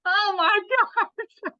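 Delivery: high, loud, slow laughing "oh my gosh"; laughing disbelief. Real, not acted. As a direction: laugh it out in shock and joy.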